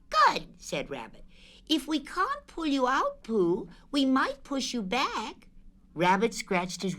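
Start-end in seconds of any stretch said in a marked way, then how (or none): 0.1-0.5 high, lively Rabbit voice; high fussy rabbit; Rabbit's voice. Acted. Rabbit voiced in read story. 1.7-5.5 high, lively Rabbit voice; high fussy rabbit; Rabbit's voice. Acted. Rabbit voiced in read story.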